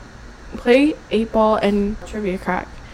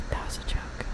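hushed, whispered disclaimer; whispered aside; 13 dB quieter than usual. Real, not acted. Owning up after joking to a friend, whispered. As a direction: whisper it as a confession.

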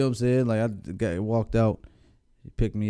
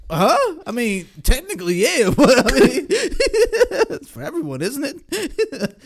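higher, slow, laughing through it; mock confused teen, higher and laughing; laughing confused son; 11 semitones higher, slower than usual. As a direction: act puzzled while cracking up. Playing confused son answering.

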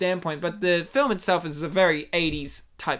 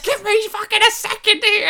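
much higher, louder mock yell; exasperated yell; 13 semitones higher, 5 dB louder than usual. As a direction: play a man losing all patience. Acts out man losing patience.

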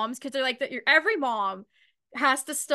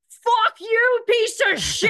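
higher, louder mock furious swearing; mock mom road rage; 10 semitones higher, 7 dB louder, slower than usual. Mom swearing at drivers, hammed-up road rage. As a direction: let the mom blow up at traffic. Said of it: "Your moms are like".

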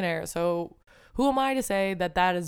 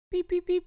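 high playful beeping noise; pitched-up mock robot beeps; machine beeps; 12 semitones higher than usual. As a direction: chirp it like a little machine. Imitating a brain rewiring machine.

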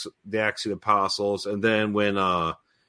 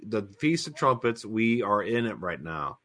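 ordinary delivery

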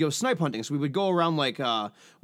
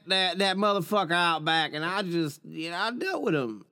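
put-on Texas accent; 6 semitones higher than usual. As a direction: brag about it like a Texas trailer wife. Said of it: "can you do a Texas accent of her".

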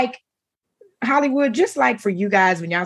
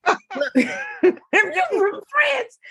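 high and slow, laughing through it; 14 semitones higher, much slower than usual. Real, not acted. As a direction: crack up at the absurd claim. Laughing at the fake French claim.

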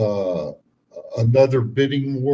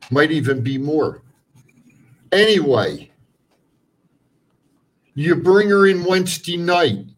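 higher and louder as he goes; building rant; 6 semitones higher, 7 dB louder than usual. Acted. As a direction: build the complaint into a tirade.

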